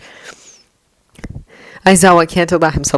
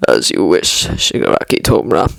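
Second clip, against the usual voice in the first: very deep villain servant voice; deep, mock-deferential reply; sarcastic obedience; 13 semitones lower than usual. A narrator's reading. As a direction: give in with low, dry sarcasm. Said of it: "knowing the sarcasm will be lost".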